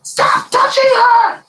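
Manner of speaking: high, loud shout at a stranger; retold shout. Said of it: "and she goes".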